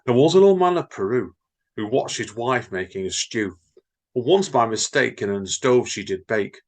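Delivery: bouncy sing-song limerick reading; jaunty comic verse reading. Acted. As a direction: read the nonsense verse with playful rhythm. Reads Edward Lear limericks.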